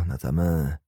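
plain reading voice

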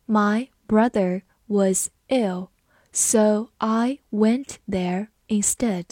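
slow, word by word with pauses; slow read-along. Shown as a demonstration. Teacher reading the brother example for students, slowly. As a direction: space out each word for learners. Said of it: "好，我们慢读一遍".

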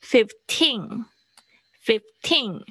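put-on "fifteen" drawn out slowly, weight on "teen"; slow "fifteen", punch "teen" and let its final n ring; stress on second syllable. Shown as a demonstration. Teacher modelling the number for students, end-stressed "fifteen". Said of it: "它的重音是在后面".

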